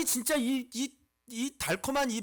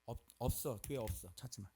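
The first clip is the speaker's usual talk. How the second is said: low, very soft muttering; barely voiced brush-off; hushed dismissal; 10 semitones lower, 11 dB quieter than usual. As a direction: murmur it as a secret warning. Acting a hushed dismissal of searching.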